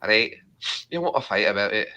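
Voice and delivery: mock-combative Scot; Scottish accent. Streamer playfully offering a fight, Scottish accent. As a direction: challenge a mate in broad Scottish. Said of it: "your Scottish accent".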